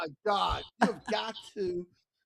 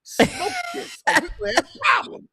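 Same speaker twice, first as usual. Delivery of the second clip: menacing threat; 3 semitones higher than usual. Retold or imitated. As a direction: start cool, then explode into a threat. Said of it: "Wayne Brady goes".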